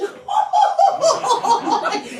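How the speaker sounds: high, loud cackle, put on; imitated uncle laughing. Uncle laughing while pouring borax, loud mock cackle. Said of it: "and he's just laughing".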